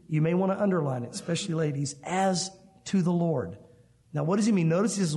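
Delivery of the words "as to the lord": slow "as to the Lord" with weight on "to"; emphasis on key phrase. Shown as a demonstration. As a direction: spell out "as to the Lord" slowly. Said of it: "and this is key".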